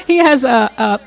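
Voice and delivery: ordinary delivery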